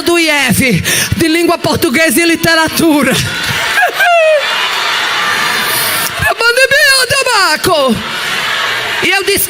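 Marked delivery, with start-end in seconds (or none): 3.2-9.0 a cry of delighted laughter; high joyful shout of laughter. Real, not acted. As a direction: burst out in a high shrieking laugh. Joyful laugh at daughter's healing.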